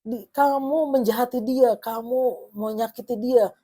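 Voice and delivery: hammed-up loud accusation; mock yell. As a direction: imitate someone crying out to the neighbours. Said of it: "Dia teriak ke semua tetangga kamu bilang".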